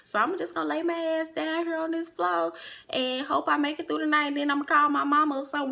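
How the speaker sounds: high-pitched, rambling self-talk; retold inner monologue. Drunk girlfriend on the floor planning to call mama, inner monologue.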